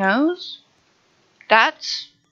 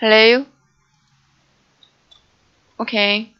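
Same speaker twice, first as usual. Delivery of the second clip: normal talking voice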